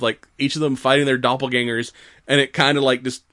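ordinary delivery

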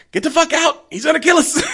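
high, loud frantic yell; mock panicked shout. Fleeing kid yells a warning at the boyfriend. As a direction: scream the warning while fleeing. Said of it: "he's like".

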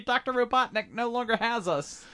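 very high squeaky cheer; tiny cartoon creatures celebrating in a high pitch; freed critters. Sonic's little critters scurry free, high cartoon cheer.

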